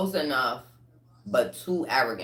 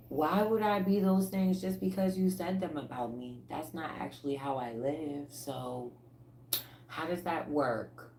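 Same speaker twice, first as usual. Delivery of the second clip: innocent confusion; 7 dB quieter than usual. Retold or imitated. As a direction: ask it gently, baffled. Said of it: "you looking like".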